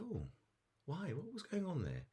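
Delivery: high, puzzled snake voice; questioning snake parent. Acted. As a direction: ask as the acted parent, pitched right up. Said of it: "And she said".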